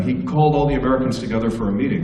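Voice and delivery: normal talking voice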